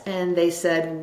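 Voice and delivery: ordinary talk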